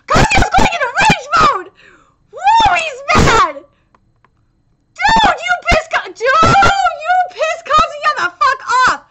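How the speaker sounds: loud, high, thrilled reaction; hyped shouting. Real, not acted. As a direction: holler like a fan at a big moment. Hyped as hero enters rage.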